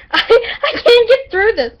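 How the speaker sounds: high, loud laughing outburst; helpless giggles. Real, not acted. Reader can't finish the story, laughing helplessly. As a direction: squeal it out while cracking up. Giggling fit interrupts the reading.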